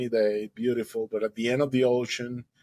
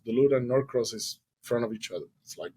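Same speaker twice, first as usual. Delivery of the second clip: ordinary delivery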